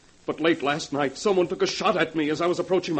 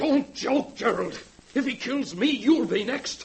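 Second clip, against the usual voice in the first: higher, anxious protest; scared man begging his partner to take it seriously; frightened pleading; 6 semitones higher, slower than usual. Acted. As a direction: plead in rising panic.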